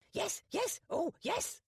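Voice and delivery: very high squawking parrot. Acted. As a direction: chirp it out like a bird. Parrot mimics.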